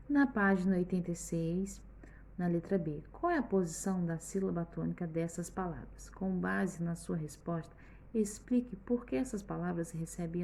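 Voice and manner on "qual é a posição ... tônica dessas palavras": ordinary talk, nothing marked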